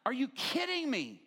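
high, slow incredulous. Retold or imitated. Husband to wife going for prayer, high slow disbelief. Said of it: "And I'm like".

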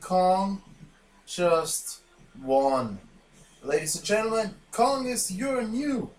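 loud, high champion announcement; ring announcer. Acted. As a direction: boom it out like a ring announcer crowning the winner.

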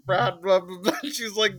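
ordinary talk

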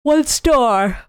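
yawning. Real, not acted. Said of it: "I need to stop yawning".

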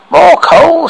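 loud, scratchy command; forceful order in a rough voice; low, scratchy voice. A narrator's reading. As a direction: call it out with a scratch in the voice. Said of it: "the voice low and scratchy".

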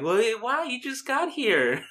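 very high, drawn-out mock protest; high-pitched retold dismay; protesting aunt. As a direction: imitate an aunt objecting to guests leaving.

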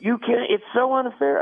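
high mock whine about unfairness; mocking whiny liberal. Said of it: "you see the left all of a sudden".

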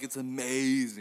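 hammed-up raving about a product; mock amazement. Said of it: "I'm like".

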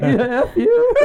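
mock curse swallowed in laughter; laughing. Retelling his customs blunder, laughing through the quote. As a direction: laugh through the retold line.

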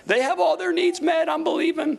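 piercingly high mock praise voice; fake rejoicing. Believer hiding bad times behind hallelujahs, high put-on praise. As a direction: parody a believer over-rejoicing through hard times. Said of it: "when things are really bad, we just".

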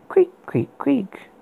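high breathy creak sounds; whispered creaking. Acted. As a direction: whisper the bed's creak eerily. Creaking bed sound imitated.

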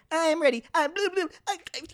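very high cartoon sponge; SpongeBob voice. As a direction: squeak like SpongeBob.

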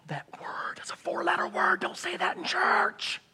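gasp, then a forceful, hissed whisper; scandalized stage whisper. Acted. Churchgoer shocked at a confession in church, scandalized stage whisper. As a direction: whisper it in mock horror, stretching the last words.

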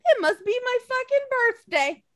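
high, delighted gush; giddy with joy; thrilled. Retold or imitated. At the surprise match, a high giddy gush. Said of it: "I'm over here like".

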